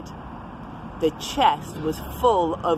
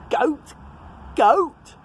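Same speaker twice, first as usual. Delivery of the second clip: appalled pirate; 9 semitones higher, 4 dB louder than usual. Acted. Pirate chief appalled at goat.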